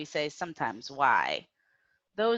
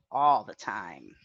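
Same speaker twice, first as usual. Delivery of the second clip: ordinary talk, nothing marked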